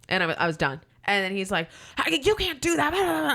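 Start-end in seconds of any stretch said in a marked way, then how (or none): 2.0-3.3 high, indignant protest into blah; hammed-up high-pitched objection; mock yelling caller. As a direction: mimic an outraged guest. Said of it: "you are literally yelling".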